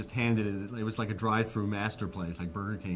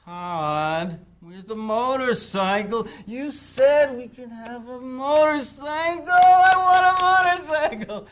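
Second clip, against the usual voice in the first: high, drawn-out whine, climbing louder; whining kid; 12 dB louder, much slower than usual. Retold or imitated. To Todd, a kid nagging for a motorcycle, high whine. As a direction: beg like an annoying little kid. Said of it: "whining kid going".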